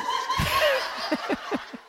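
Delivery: real helpless squealing laughter; uncontrolled giggles; high giggling laugh. Real, not acted. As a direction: laugh hard, high-pitched. Laughs at own joke.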